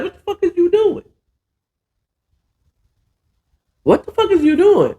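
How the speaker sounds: high, fast, incredulous demand; exasperated Akeem. Retold or imitated. Akeem confronting Champ in the back, high fast disbelief. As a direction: squeal it out at him in disbelief. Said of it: "Akeem was like".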